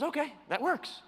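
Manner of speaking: imitating a satisfied buyer; agreeable customer. Customer accepting six dollars a foot, lightly voiced high agreement.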